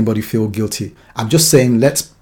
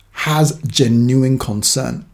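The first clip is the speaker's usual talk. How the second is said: usual speaking voice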